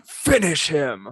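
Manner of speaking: slow, loud drawn-out command; fighting game announcer. Shown as a demonstration. Host showing the game line, loud announcer. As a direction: stretch it out and punch it. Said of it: "Give me your best Mortal Kombat finish him".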